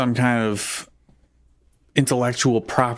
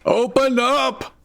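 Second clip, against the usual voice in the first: high, slow pleading shout; loud shout; slower than usual. Acted. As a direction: call it out high and drawn out. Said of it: "shouted Johnny Vigilant louder".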